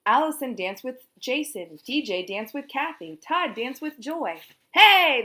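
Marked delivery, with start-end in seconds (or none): 4.7-5.2 loud high yell. A narrator's reading. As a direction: shout it on the beat. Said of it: "they all shouted together".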